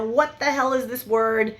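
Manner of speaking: ordinary delivery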